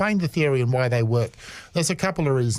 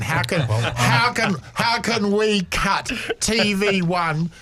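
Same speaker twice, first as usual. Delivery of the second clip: excited; 8 semitones higher, slower than usual. Real, not acted. Excited wish to rival broadcasters.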